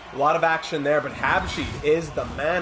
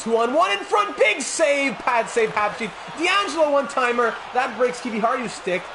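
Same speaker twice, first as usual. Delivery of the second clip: much higher and louder as the chance builds; excited play-by-play; 10 semitones higher, 6 dB louder than usual. Real, not acted. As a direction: call the scramble at full excitement. Scramble in front of net.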